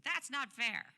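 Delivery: put-on a stamping child pleading; childish protest. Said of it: "stamping my feet".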